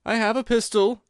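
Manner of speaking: a frightened man warns off intruders in the dark; reedy, quivering. A narrator's reading. Frightened man on the stairs threatens the visitors, reedy and quivering. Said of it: "a reedy, quivering voice".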